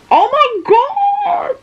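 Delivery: very high, drawn-out squeal; shocked squeal. Real, not acted. Reader floored by a plot turn, long high shocked squeal. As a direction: shriek it thinly, holding the last word.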